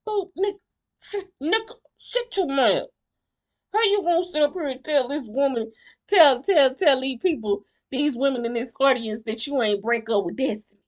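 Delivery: high, agitated telling-off with rising pitch; heated scolding. Retold or imitated. Host imagining scolding the man on stage, heated high scolding. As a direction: pretend you're chewing him out in front of everyone.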